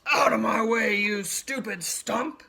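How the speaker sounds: loud furious bellow at the stump; angry snort. A narrator's reading. Stuck rhino yelling at the stump, loud furious snort. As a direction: roar it, charging. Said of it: "he snorted".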